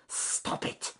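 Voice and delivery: whispered snake hiss. Acted. Snake finally opens its mouth and hisses at the rook.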